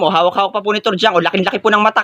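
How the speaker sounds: ordinary talk, nothing marked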